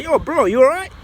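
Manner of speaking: high, loud and casual, like a stranger checking on you; friendly call-out. Retold or imitated. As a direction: call across to a lost newcomer, bro. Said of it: "comes up to me and says".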